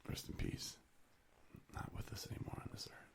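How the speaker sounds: whispered respectful aside; soft reverent whisper. Real, not acted. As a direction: hush it like at a graveside. Respectful aside about dead singer.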